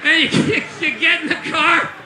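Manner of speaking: ordinary delivery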